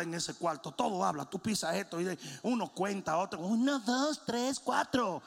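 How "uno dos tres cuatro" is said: higher sing-song toy count. Retold or imitated. A toy counts aloud to the preacher, higher toy voice. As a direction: count along like an electronic toy.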